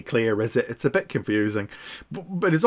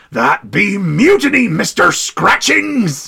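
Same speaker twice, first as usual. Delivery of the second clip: pirate captain; 7 semitones higher, 6 dB louder, slower than usual. Acted. Voicing Plunder's line.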